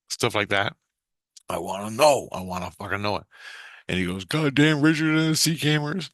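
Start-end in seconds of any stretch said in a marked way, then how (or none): 4.2-6.1 higher put-on imitation of a man's curse; imitated grumbling curse. Teller mimics Jesus cursing Richard, amused imitation. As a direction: mimic his funny way of cursing. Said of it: "so funny the way he said it".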